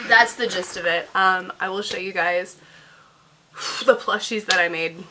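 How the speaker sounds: ordinary talk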